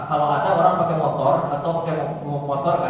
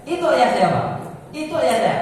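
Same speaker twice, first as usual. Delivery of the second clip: usual speaking voice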